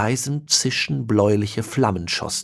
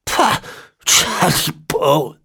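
high, slow, far-off spat-out contempt; spitting the name; 7 semitones higher, 3 dB louder, slower than usual. A narrator's reading. Ezekiel spitting out his enemy's name, slow and high. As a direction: spit the name out. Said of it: "spuckte den Namen förmlich hervor".

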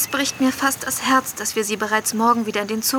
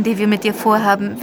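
usual speaking voice

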